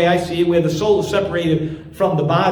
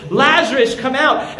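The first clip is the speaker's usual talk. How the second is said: loud call; 7 semitones higher than usual. Acted. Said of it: "Jesus cried out in a loud voice".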